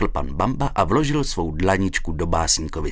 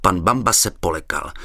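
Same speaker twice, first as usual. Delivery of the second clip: ordinary delivery, nothing marked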